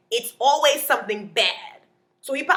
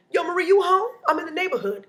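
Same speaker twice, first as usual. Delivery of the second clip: lightly voiced chirpy caller, higher; casual pitched-up phone greeting; friend calling; 9 semitones higher, 4 dB louder than usual. Friend's phone call acted out.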